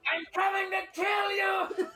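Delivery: high, slow screamed threat; Sam Hyde impression. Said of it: "the Irish accent, like Sam Hyde".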